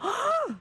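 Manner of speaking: high sudden overdone gasp; exaggerated startled inhale; dramatic gasp. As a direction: gasp as if shocked by the phone. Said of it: "gasping way too dramatically".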